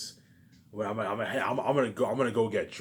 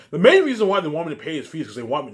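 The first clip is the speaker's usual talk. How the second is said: raised, higher voice in a rant; heated vlog rant, louder and higher; 6 semitones higher, 9 dB louder than usual. Real, not acted. Ranting about the fans.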